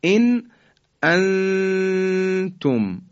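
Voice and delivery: انتم with the نون hidden in a drawn-out nasal hum, very slow; انتم recited slowly with ikhfa nasalisation; Quranic recitation demo. Shown as a demonstration.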